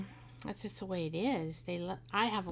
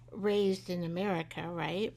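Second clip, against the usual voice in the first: ordinary talk, nothing marked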